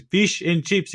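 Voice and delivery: put-on Indian accent. Waitress answering the customer in a mock Indian accent. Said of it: "She goes".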